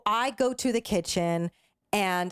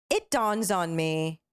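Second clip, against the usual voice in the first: ordinary talk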